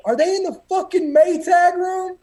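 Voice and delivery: high, loud furious demand, as the retold senior; angry yell. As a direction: bark it like an enraged drill boss. Senior instructor discovers crammed recruits.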